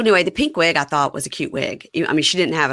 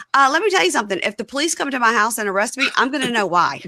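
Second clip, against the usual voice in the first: higher-pitched, sassy imitation of indignation; indignant retort; 7 semitones higher, 4 dB louder, faster than usual. Host mimics girlfriend doubting his story, indignant retort. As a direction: set them straight, playfully acted.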